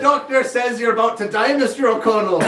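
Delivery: high, loud, hammed-up Irish brogue; mock Irish accent. Joking as a nurse to a patient, mock Irish accent. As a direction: break bad news in a jolly Irish lilt. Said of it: "at least she wasn't like".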